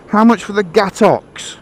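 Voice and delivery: high, slow, over-clear question; slow for a foreigner. Acted. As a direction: spell it out for a foreigner. Englishman spelling it out for foreigner.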